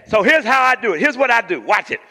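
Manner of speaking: put-on high, loud old-school preacher; loud preacherly impression; Doctor Fred Sampson. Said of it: "This is how he talked, y'all".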